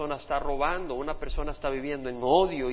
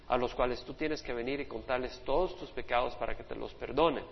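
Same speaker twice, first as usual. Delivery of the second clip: ordinary talk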